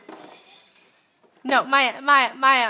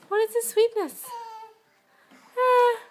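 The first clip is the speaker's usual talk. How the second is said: much higher cooing what; 11 semitones higher, 4 dB louder, slower than usual. Real, not acted. Baby talk to infant.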